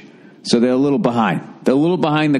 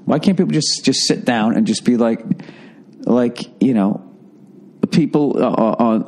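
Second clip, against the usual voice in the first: ordinary talk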